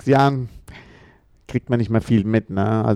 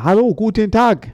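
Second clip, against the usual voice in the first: much higher, louder broken foreigner-talk German; mocking the club boss in exaggerated simple German; condescending boss; 11 semitones higher, 5 dB louder than usual. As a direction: talk down to him in pidgin German, pitched way up. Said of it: "sagt er".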